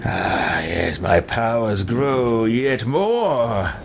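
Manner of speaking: slow, savouring villain gloat. Acted. Villain feeling his powers grow, slow gloat. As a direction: drawl it out, relishing the power.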